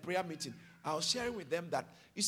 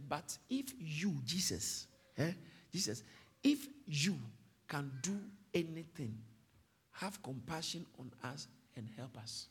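desperate plea; 6 semitones lower, 8 dB quieter than usual. Retold or imitated. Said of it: "But the man said".

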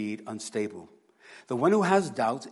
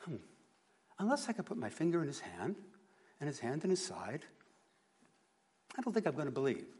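skeptical Thomas; 2 semitones higher, 7 dB quieter, faster than usual. Retold or imitated. Preacher guessing doubting Thomas's tone, quicker and skeptical. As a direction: muse it out like an unconvinced Thomas. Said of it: "what the tone of voice was".

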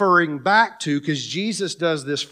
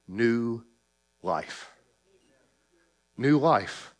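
hushed; 6 semitones lower, 7 dB quieter, slower than usual. Acted. Quiet climax of sermon.

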